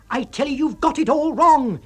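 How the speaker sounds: high, loud exasperated insisting; loud high insistent shout; roar. A narrator's reading. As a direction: roar it at him. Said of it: "you roar".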